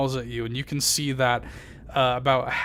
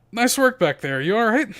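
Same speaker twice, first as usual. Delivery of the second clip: Yagra, friendly; 8 semitones higher, 5 dB louder than usual. Acted. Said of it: "just like".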